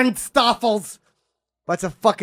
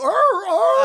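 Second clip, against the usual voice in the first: pirate growl; 13 semitones higher than usual. Pretend. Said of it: "I would go, like".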